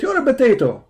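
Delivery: taunting. Acted. Taunting opponent with name.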